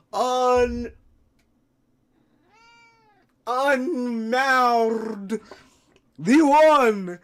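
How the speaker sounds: booming wrestling announcer, stretching every title; big arena announcer voice rising to a bellow; ring announcer intro. Acted. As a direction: build the hype to a roaring climax. Hypes the pet like a ring announcer.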